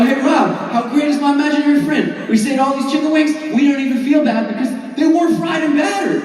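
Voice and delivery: high, rapid-fire gushing; mock giddy. Bragging to a pal about the imaginary friend, giddy. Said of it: "And then I go, I go".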